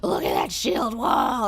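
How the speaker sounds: slow, loud, dazed cry; a high woman shouting at her visions, dazed; tripping. Retold or imitated. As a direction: call it out in drugged awe. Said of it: "tripping the fuck out".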